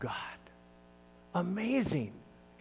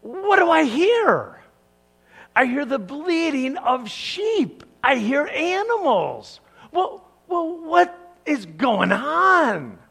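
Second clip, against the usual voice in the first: higher, playful feigned surprise; mock innocent Saul; 9 semitones higher, 6 dB louder than usual. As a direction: act like you just noticed the sheep. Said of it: "And he said".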